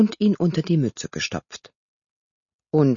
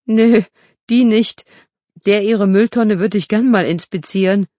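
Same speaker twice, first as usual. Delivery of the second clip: put-on loud, high, cheeky street-kid taunt; loud and high teasing boast; 6 semitones higher, 7 dB louder than usual. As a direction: call it out loud and mocking. Kid jokes loudly.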